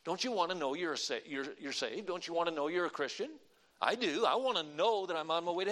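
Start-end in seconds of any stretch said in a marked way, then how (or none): none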